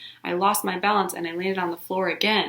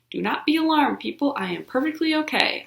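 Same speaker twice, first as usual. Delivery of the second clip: mock-grand announcement; 7 semitones higher than usual. After falling, addressing the class.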